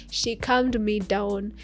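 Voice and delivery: ordinary talk, nothing marked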